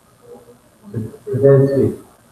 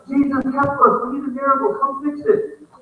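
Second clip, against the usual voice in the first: higher-pitched urgent plea; mildly dramatized cry for a miracle; pleading disciples; 7 semitones higher than usual. Acted.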